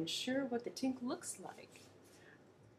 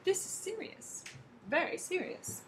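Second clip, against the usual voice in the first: worried; 9 semitones higher than usual. Acted. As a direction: fret over the bad news. Worried Gumble thinking aloud.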